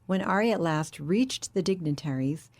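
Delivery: usual speaking voice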